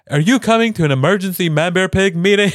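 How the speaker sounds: hammed-up pleading cartoon politician; urgent Al Gore. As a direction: beg him to come like it's a crisis. Al Gore phoning Stan crying.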